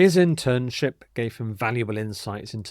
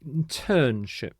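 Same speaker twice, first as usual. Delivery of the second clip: slow Internship, TERN drawn out and stressed; middle-syllable stress; slower than usual. Shown as a demonstration. Teacher repeating the word for learners, Internship slowly stressed on TERN. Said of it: "the stress is on this middle syllable again".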